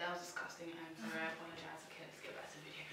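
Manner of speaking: hushed embarrassed whisper. Real, not acted. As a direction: murmur the apology under breath. Embarrassed apology after gross moment.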